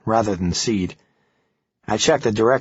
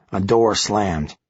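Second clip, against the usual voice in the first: plain reading voice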